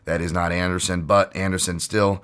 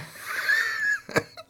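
high shrieking laugh; 4 dB quieter than usual. Real, not acted. Laughing at his own impression.

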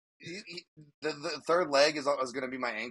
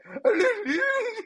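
imitated wailing yell; 6 dB louder than usual. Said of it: "He's just like".

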